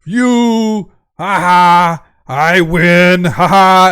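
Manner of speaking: loud, crowing giant laughter; gloating giant. Acted. Giant winning the haggle, loud gloating voice. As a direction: boom out triumphant ha-has.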